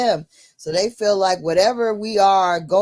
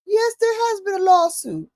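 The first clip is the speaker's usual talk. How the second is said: much higher put-on assistant voice; phone AI voice; 11 semitones higher than usual. The AI confirming a lawsuit, high mock assistant voice. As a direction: chirp it like a helpful AI, pitched well up. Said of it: "talking to me in a British voice".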